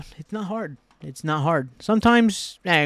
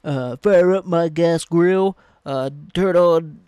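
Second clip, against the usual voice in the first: louder, slow mock country guy; mocking country singer; 2 semitones higher, 7 dB louder, slower than usual. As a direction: ham up the good ol' boy, slow. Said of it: "the dude from Big and Rich is like".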